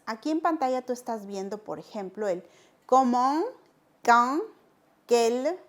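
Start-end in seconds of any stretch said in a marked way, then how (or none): 2.9-3.4 comment said the French way, nasal end. Shown as a demonstration. Teacher modelling comment for Spanish learners. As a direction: model comment slowly for the class. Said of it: "estas son las palabras interrogativas".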